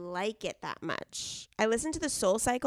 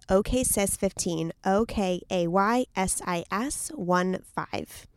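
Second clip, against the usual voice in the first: slow, letter by letter code; spelled out slowly; 2 semitones lower, slower than usual. Shown as a demonstration. Host spelling the promo code, slow and deliberate. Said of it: "spelling promo code slowly".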